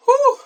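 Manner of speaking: loud, high exertion whoop; high whoo. Real, not acted. Man working out after a deadlift demo, loud high whoo. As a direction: let out a short, high "whoo".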